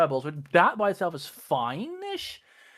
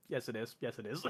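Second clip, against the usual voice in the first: much softer, lower muttered yes; hushed self-correction; 6 semitones lower, 13 dB quieter than usual. Retold or imitated. Emperor quietly conceding the order was right, much softer. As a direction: murmur it to yourself, conceding.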